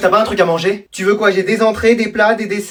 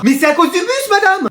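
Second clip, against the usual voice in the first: high, pleading excuse; protesting pupil; 10 semitones higher than usual. Acted. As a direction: whine your excuse to the teacher. Pupil protesting over lateness. (élève qui proteste d'une voix aiguë)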